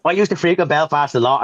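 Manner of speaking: normal talking voice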